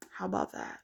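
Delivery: "How about that?" whispered low under the breath; whispered aside. Real, not acted. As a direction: mutter it again as a voiceless aside. Repeats jab under breath.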